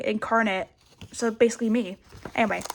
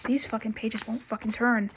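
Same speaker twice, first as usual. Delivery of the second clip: ordinary talk, nothing marked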